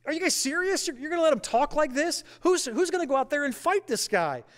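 put-on high, incredulous, fired-up young challenger; indignant disbelief. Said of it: "he's like".

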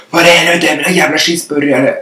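loud, high angry yell, acted out; retold shouting. Someone in the drive-through yelling for a burger, mock shouting. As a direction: imitate someone yelling in a temper. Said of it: "började skrika och så bara".

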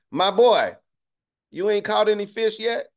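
casual friendly shout-out in street talk; Jesus in street slang. Retold or imitated. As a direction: hail your boys from the shore, slang style. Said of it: "I'm gonna say it in my term".

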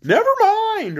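very high, loud, drawn-out reassurance; comforting Scarecrow. Acted. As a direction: swoop up high to console. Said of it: "urged the Scarecrow in a comforting voice".

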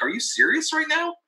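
very high incredulous mock protest; retold exasperation, pitch shooting up; frustrated disbelief. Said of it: "so mad and so frustrated".

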